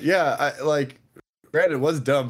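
ordinary delivery

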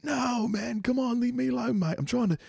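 put-on very high, whiny British accent. Imagined British bridge fan shooing off a stalker, very high British accent. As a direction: beg to be left alone in a squeaky British accent. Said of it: "I made him British".

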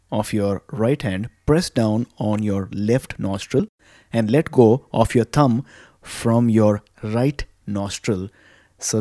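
soft, unhurried guided breathing voice; calm meditative tone. Acted. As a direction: guide them gently through each breath. Leads breathing exercise.

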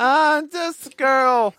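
high, slow girly mock voice. Friends mocking the card line in a high voice. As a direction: drag it out high to tease.

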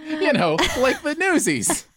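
very high, slow, laughing hard; cracking up mid-sentence. Real, not acted. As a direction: barely get the words out for laughing. Cracking up over Newsies mix-up.

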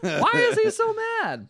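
very high, loud and slow mock bafflement; exaggerated baffled wail. Podcaster repeating their reaction for laughs, exaggerated baffled wail. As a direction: stretch it into a hammed-up, high-pitched cry.

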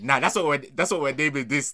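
usual speaking voice

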